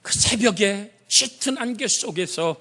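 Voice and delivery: high, storytelling sermon voice; preaching. Acted. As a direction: paint the seashore scene for the flock. Preacher narrating the gospel scene.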